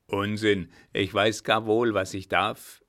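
loud, forceful rebuttal; old commander overruling a protest; brusque dismissal. Acted. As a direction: brush off the objection loudly. Old commander brushes off official's protest.

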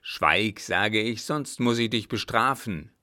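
stern warning at normal pitch; threatening captain. Acted. Father threatening his son with punishment, stern. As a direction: threaten with forceful command.

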